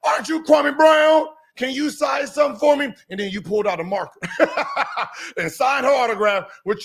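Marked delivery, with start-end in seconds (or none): 0.0-2.9 high, loud excited fan. Retold or imitated. Host voicing an excited fan, high and loud. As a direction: play the fan who spots him.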